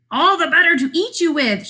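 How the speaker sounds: a mild hammed-up bear yell; bear shouting. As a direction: rush it out like a hungry storybook bear. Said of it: "shouted the bear".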